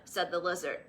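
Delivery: plain reading voice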